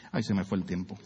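aside to self. Real, not acted. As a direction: mutter it to yourself. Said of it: "aside about time".

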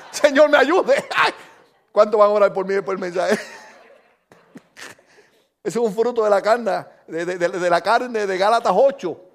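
chuckling confession through laughter; self-mocking giggle; laughing at himself. Real, not acted. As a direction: laugh it off sheepishly. Laughs at his own pride.

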